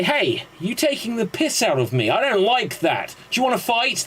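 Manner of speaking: high-pitched hammed-up challenge; mock confrontation. Acted quarrel in a bar joke.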